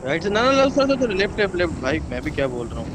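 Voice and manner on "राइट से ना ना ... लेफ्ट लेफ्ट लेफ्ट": high-pitched, urgent repeated directions; frantic correction. Real, not acted. Squad leader switching the route from right to left, frantic. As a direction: blurt the correction, pitch leaping up.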